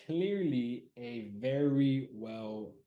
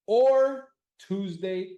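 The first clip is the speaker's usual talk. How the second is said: Tuesday stretched long, higher and louder; drawn-out emphasis; 10 semitones higher, 13 dB louder, much slower than usual. Shown as a demonstration. Streamer spelling out the second poll option to chat, slow and loud. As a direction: lean hard on "or Tuesday" so chat can't miss it.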